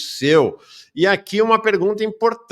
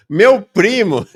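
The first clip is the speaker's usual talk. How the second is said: loud, raised shout of greeting; mock shout; 4 semitones higher, 8 dB louder, slower than usual. Host reading out a viewer's greeting, mock shout. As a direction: call it out like an excited viewer's shout. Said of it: "O Helder Maia já grita".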